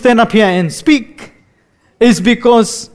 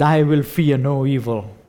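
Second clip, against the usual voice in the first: quiet, falling, fading near a whisper; like a dying man, barely audible; hushed dying voice; 8 semitones lower, 7 dB quieter than usual. Retold or imitated. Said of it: "the dying voice swelled".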